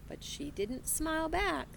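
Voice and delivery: ordinary delivery, nothing marked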